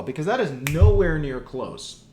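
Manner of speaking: ordinary talk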